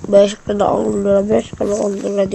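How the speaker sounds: loud hawker calling prices; street vendor call. Retold or imitated. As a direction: shout prices like a market seller. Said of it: "diye bağırıyor".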